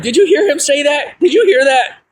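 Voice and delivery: excited, high-pitched double-check; amazed disbelief. Retold or imitated. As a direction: press the astonished question, voice way up. Retells amazed question to siblings.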